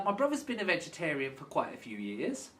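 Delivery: ordinary delivery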